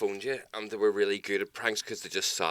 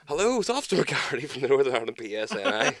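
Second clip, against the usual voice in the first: higher, slightly louder fake officer; mock officer; 6 semitones higher, 4 dB louder than usual. Calling the takeaway as Officer McGarry, higher. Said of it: "it's like".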